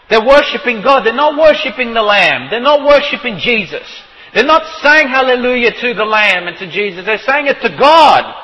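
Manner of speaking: loud, high fervent preaching; preaching at full force. Acted. Preacher hammering his point to the congregation, fervent. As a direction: drive the point home to the pews.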